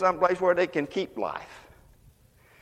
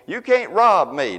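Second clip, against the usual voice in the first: rebuking the devil; 5 semitones higher than usual. Retold or imitated. Church sister rebuking the devil over her bill. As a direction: order the devil off with a raised voice. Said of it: "began to tell the devil and point at him".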